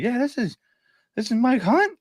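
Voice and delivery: high-pitched caller impression; weird voice. Caller's wife answering the phone, imitated in a weird high voice. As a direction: imitate an odd woman way up high. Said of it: "She had this weird voice".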